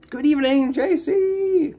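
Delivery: high, loud cheery hello; bright high greeting. Real, not acted. Streamer welcoming a chat viewer, loud high greeting. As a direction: call the welcome out with big energy.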